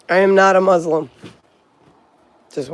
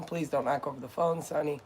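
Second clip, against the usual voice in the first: quiet plea to dog; 2 semitones lower, 14 dB quieter than usual. Real, not acted. Gently pleading with her dog.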